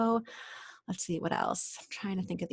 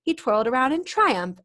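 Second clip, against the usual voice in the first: plain reading voice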